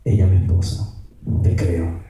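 low, subdued prayer; retold tearful prayer. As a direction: confide it to God, voice dropped low. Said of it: "I looked at her and I started crying".

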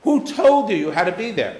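loud, high and insistent; pressing demand. Retold or imitated. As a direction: corner them with the question. Interrogating a frustrated traveler.

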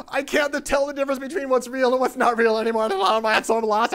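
high, loud hysterical lament; acted freaking out. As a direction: wail it out like someone losing his mind. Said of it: "He's freaking out".

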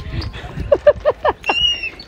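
high squealing laugh; burst of laughter. Real, not acted. Laugh after yelling.

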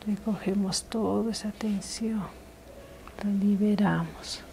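soft ASMR whisper; intimate whisper. Acted. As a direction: breathe the words gently, close to the mic. ASMR massage, whispered guidance.

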